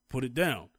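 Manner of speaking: usual speaking voice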